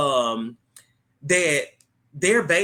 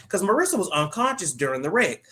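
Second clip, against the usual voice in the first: ordinary talk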